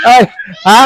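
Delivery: normal talking voice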